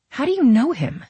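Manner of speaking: put-on high, curious young woman's question; Camille. A narrator's reading. Camille asking Nola about Royal, high and curious. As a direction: pitch it up, asking inquisitively.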